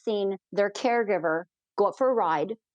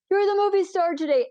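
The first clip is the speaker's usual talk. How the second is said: high-pitched retold call, little louder; mock yell; 10 semitones higher, 3 dB louder than usual. Pilot cheering on a scared rider, imitated yell. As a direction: call it out encouragingly, much higher. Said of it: "And I yelled, I said".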